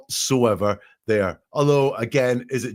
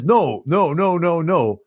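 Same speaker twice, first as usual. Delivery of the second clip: louder, higher string of refusals; retold protest; 6 semitones higher, 4 dB louder, slower than usual. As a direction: imitate a distressed caller protesting. Acting out caller's repeated denial.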